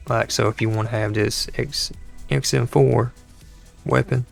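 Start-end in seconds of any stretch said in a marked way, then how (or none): none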